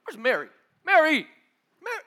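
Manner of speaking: rising call for Mary, loud at the end; Martha calling out. Acted. Martha looking for her sister, calling out. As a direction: call across the house for Mary. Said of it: "She's like".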